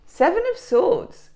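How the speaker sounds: card reveal. Real, not acted. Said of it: "announces card".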